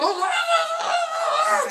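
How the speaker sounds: shrill strangled laugh; laugh-choke mid-struggle; high gagging laugh. Retold or imitated. Taylor gag-laughs as aunt forces lemonade down, high strangled laugh.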